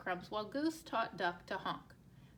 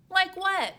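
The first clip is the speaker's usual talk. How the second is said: eager question; 8 semitones higher, 11 dB louder than usual. Acted. Duck asks eagerly.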